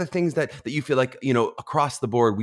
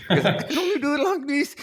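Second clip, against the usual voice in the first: much higher, laughing put-on Kiwi voice; high pitched Kiwi attempt. Shown as a demonstration. Host demoing her high-pitched New Zealand accent, laughing. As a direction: squeak it up high, laughing at yourself. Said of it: "in a very high pitched voice".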